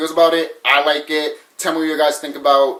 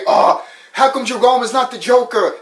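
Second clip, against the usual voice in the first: high-pitched mock protest; whiny mock outrage from a fan; complaining fans; 7 semitones higher than usual. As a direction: imitate indignant fans, pitched well up. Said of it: "People will say".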